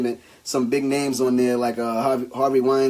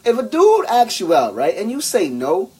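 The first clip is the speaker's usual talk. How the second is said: usual speaking voice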